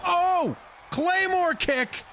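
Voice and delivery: excited shout. Real, not acted. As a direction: belt out the move name. Commentator calls a big move.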